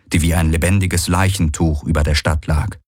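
usual speaking voice

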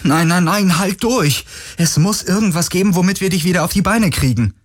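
high, urgent, pleading voice; panicked urging, rushing at the end; desperate plea. A narrator's reading. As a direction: beg him to hold on. Galen pleads with dying friend.

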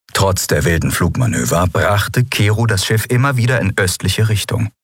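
ordinary delivery

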